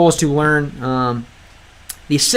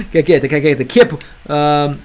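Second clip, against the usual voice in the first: ordinary talk, nothing marked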